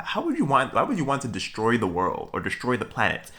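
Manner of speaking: ordinary talk, nothing marked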